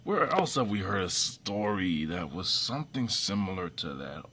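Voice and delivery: normal talking voice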